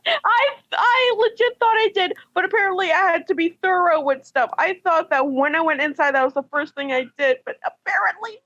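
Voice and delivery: high, stammering self-defense; flustered protest. Real, not acted. Explaining the forgotten letter to the group, flustered protest.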